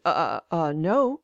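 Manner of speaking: retold stammer, caught off guard. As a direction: stammer out a hesitant answer. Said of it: "I stammered".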